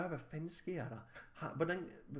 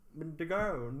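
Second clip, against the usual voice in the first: ordinary talk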